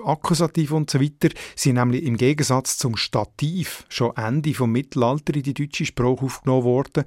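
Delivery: normal talking voice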